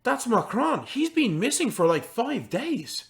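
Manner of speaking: high-pitched, swooping voice of recognition; Dubois, alarmed. Acted. Dubois spots the missing farmer asleep, alarmed. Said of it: "as Dubois looks, he says".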